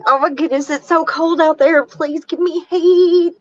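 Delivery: mock dog voice. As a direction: beg for warmth like a shivering pup. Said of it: "he's like".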